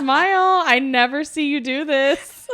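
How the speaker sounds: high, loud teasing call to smile; loud playful teasing of mom; teasing cheer. Retold or imitated. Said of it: "I was like".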